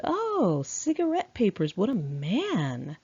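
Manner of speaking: high, sarcastic mock praise; mocking sarcasm. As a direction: tease him with fake admiration. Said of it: "And I laughed".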